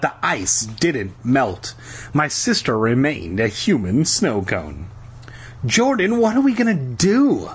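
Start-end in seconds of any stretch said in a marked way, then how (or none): 5.7-7.5 high-pitched distressed wail. A narrator's reading. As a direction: wail helplessly in a high girl's voice. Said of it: "Lauren wailed".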